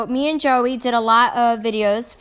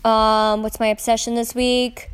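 ordinary talk

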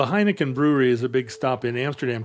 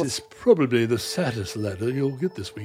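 bad upper-class British accent; a weak stab at a Received Pronunciation accent; 3 semitones lower, 3 dB quieter than usual. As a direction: read it as a posh British letter writer. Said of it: "doing this in a British accent".

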